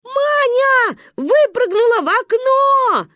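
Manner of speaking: put-on high, loud, drawn-out child's scream; panicked shout. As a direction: scream the alarming news slowly. Children scream news to grandmother. (панический детский крик, протяжно)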